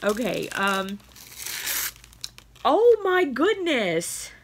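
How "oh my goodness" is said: high, drawn-out amazed gasp of words; real astonishment. Real, not acted. Opening a friend's gift for the cats, astonished.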